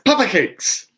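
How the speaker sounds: Papa cakes said high in a hammed-up accent; high, silly «Papa cakes» with a put-on Chinese accent; mock accent. Shown as a demonstration. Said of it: "order it that way".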